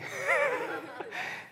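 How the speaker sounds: squeaky high laugh through tears; giggling at himself for weeping; high laughing squeal. Real, not acted. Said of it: "like, weeping".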